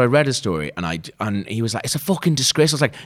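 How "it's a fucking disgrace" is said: high-pitched mock northern English accent; bad northern accent. Colleague repeating his complaint, bad northern accent. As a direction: parrot the outraged northerner, pitched up. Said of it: "that's not good accent".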